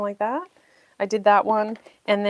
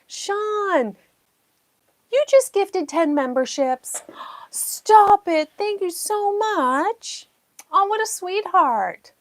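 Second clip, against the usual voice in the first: high, squealing thanks; overjoyed gratitude; 10 semitones higher, 3 dB louder, slower than usual. Real, not acted. Streamer thanking a viewer for gifted memberships, high excited gushing. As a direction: gush over the gift, voice soaring.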